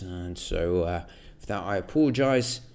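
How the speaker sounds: loud British apology; British accent. Acted. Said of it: "speaking in a British accent".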